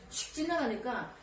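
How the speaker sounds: usual speaking voice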